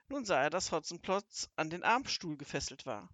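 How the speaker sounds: ordinary delivery, nothing marked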